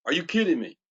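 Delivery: usual speaking voice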